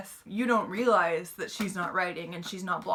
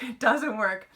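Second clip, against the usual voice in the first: louder repeat with a laugh; amused laughing; 3 semitones higher, 5 dB louder, slower than usual. Real, not acted. Reader reacting to the failed reset, amused laughing. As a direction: laugh as you say it, raised in volume.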